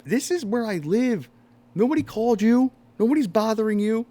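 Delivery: high, loud exasperated protest; retelling how he yelled back at the cop, high and loud; indignant protest. Retold or imitated. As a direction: argue back at the cop in disbelief. Retold arguing with the cop.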